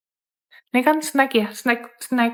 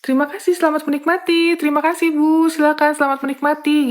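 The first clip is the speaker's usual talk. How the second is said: fast, breezy serving patter; a flight attendant rushing snacks out the usual way, fast; quick usual serving; 3 semitones higher, faster than usual. Shown as a demonstration. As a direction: rattle through the thanks quickly. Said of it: "biasanya kita langsung ngasih-ngasih aja".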